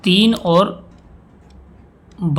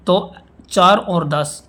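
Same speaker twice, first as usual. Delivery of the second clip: slow, deliberate restating; 4 dB louder, much slower than usual. Shown as a demonstration. Teacher slowly restates example.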